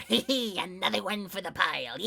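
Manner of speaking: Wario-like cartoon voice. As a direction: cackle it like a gleeful cartoon hoarder. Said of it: "Nice Wario".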